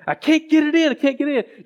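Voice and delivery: high, loud exasperated complaint; a fed-up elderly woman who can't manage it; old lady frustrated she can't slip the money in. Retold or imitated. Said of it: "she was so frustrated and she was mad".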